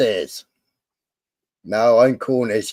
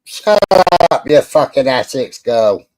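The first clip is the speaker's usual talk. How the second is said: playful shout; 3 semitones higher, 7 dB louder than usual. Real, not acted. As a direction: bark it out in fun. Mock-insulting a friend.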